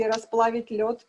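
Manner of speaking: usual speaking voice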